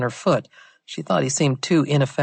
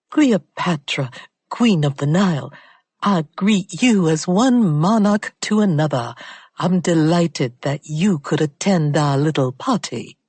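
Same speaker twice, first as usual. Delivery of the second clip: bad British accent; 2 semitones higher than usual. A narrator's reading. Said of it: "Affecting a bad British accent".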